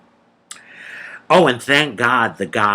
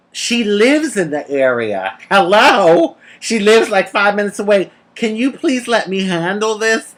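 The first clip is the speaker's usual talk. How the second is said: exasperated rebuke, retold; 7 semitones higher than usual. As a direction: imitate an officer telling a colleague to back off. Female officer rebuking colleague, retold.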